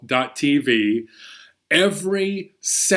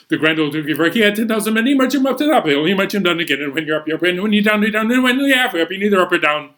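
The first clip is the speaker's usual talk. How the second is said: breakneck chant, pitched up; super fast; 3 semitones higher, 3 dB louder, much faster than usual. Shown as a demonstration. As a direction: race through the rhyme as fast as possible. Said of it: "Super fast!".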